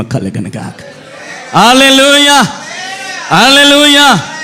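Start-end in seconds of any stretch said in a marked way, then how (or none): 1.6-4.3 loud ringing hallelujah; drawn-out loud praise shout; hallelujah call. Acted. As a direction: call it out to the church. Preacher calls out hallelujah.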